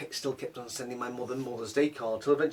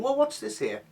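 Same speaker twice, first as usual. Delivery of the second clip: high mock fussy mum; mimicking mum; 9 semitones higher than usual. Mum questioning her soup, high mock fussiness. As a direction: imitate her peering at the soup.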